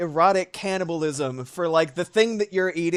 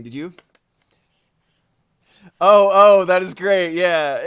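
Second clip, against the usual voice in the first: normal talking voice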